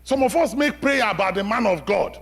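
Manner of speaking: loud, high preaching that drives each point home; preaching at full force. Acted. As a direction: proclaim it to the whole church, raising the voice. Preacher raises voice on congregation.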